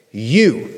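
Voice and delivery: YOU said low and firm, on its own; emphatic you. Shown as a demonstration. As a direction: lean hard on "You" to point at them. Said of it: "That you is emphatic".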